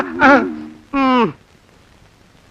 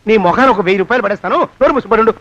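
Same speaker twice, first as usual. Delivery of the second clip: low, louder command to shut up; gruff scolding; 6 semitones lower, 3 dB louder, faster than usual. Acted. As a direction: bark it down at him. Master bribing and scolding servant.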